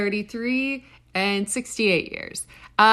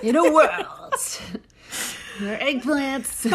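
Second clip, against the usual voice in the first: movie trailer voice; 4 semitones higher than usual. Pretend. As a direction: announce it like a blockbuster trailer. Jokes about an alien movie title.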